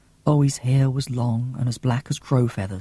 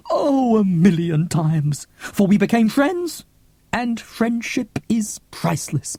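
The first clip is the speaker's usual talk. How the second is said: put-on older woman's voice, higher and fond, lingering on "beloved"; storyteller Cressy; 7 semitones higher than usual. Old woman telling the child about his mum, fondly. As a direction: tell the tale to a beloved child, savouring it.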